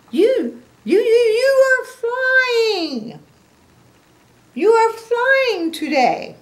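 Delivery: very high, loud, slow stammering awe; amazed stammer. A narrator's reading. Gerald seeing Piggy fly, very high stammering awe. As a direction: stutter it out in total amazement. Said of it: "Gerald says".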